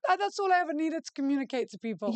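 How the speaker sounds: high, fast, lightly voiced mum. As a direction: breezily shrug it off as mum. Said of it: "She's like".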